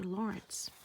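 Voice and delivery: plain reading voice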